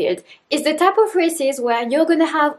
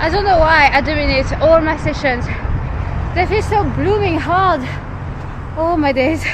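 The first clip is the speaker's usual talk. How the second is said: breathless just after hard reps; out of breath; 4 semitones higher than usual. Real, not acted. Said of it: "Painfully got through it".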